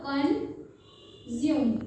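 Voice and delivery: कंज्यूम stretched out very slowly; drawn-out model word. Shown as a demonstration. Teacher modelling कंज्यूम, slowly. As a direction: say कंज्यूम long and slow for the class. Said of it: "क्या होगा?".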